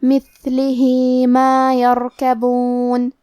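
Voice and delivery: مثلہ with the final هِ stretched two counts before ما, very slow; slow chanted مثلہ ما یرکبون with a long ه vowel; lengthened vowel demo. Shown as a demonstration. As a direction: draw out the end of مثلہ into ما. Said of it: "اپنی آواز دو مد کے برابر لمبی کریں گے".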